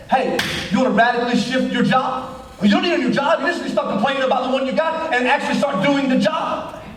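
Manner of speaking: high, driving exhortation; full-force preaching. Acted. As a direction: preach it hard and insistently.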